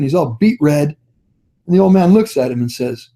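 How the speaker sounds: ordinary talk, nothing marked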